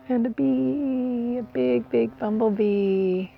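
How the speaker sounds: soft, drawn-out murmur of delight; hushed wonder. Real, not acted. Host spotting a big bumblebee mid-video, hushed wonder. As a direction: stretch it out gently, so as not to disturb. Spots a bee while filming.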